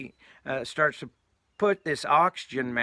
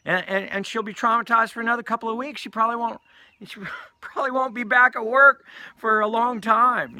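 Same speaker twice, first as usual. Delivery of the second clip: high-pitched, worried rush that grows louder; agitated fretting; 9 semitones higher, 5 dB louder than usual. Retold or imitated. Storyteller voicing friends worrying Gail will be traumatized, high agitation. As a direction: spiral upward in mock alarm.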